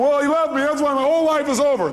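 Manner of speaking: a hint of a hammed-up dumped girlfriend; imitating heartbroken woman. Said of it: "So someone's going".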